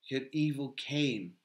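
soft, low, subdued reply; sad. A narrator's reading. Legolas answering Gimli about the evil, sadly. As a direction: answer with quiet sorrow, voice dropped. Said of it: "answered Legolas sadly".

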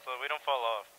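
normal talking voice

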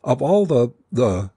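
indignant splutter that breaks off; exasperated doctor lost for words; speechless indignation. A narrator's reading. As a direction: start high, then falter for want of words. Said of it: "he stopped, evidently feeling words futile".

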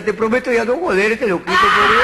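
súplica que sube a grito muy agudo; súplica gritada. Acted. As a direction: rogar desesperado. Mono suplica. (el mono suplica al negro, gritando agudo)

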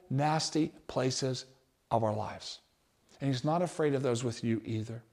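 hushed tender. Real, not acted. Preacher softly assuring the church, hushed tender. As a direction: drop almost to a murmur to draw them close.

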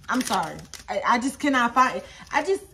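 normal talking voice

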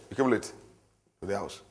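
low, soft, brooding accusation; muttered accusation. Acted. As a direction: grumble it under your breath. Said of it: "I didn't process the anger".

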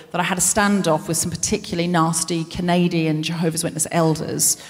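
ordinary delivery

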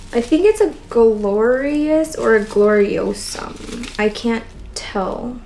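ordinary delivery

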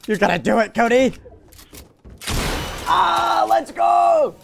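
screaming with joy, high and loud; excited cheering. Real, not acted. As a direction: cheer him on at the top of your lungs. Cheering teammate's win in game.

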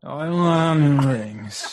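put-on slow, loud slurred drunk voice; fake drunk slurring. Shown as a demonstration. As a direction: slur it like a hammy drunk. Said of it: "show me what it would sound like".

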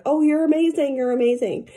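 high, bright doting praise over the cat; gushing praise. Acted. Owner praising her cat, high doting gush.

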